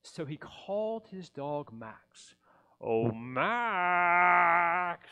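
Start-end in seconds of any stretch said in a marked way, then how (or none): 2.8-5.1 loud call across the room. Acted. Grinch calling his dog Max, loud call. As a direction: shout the dog's name to summon him.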